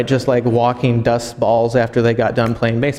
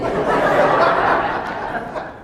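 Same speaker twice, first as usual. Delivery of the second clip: high breathy giggle; child's giggle. Retold or imitated. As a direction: laugh like a tickled little girl. Daughter's joke lands.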